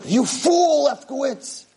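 high, indignant cry that drops at the end; acted cranky revelation; exasperated outburst. Angry revelation to roommate.

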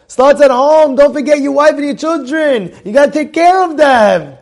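usual speaking voice

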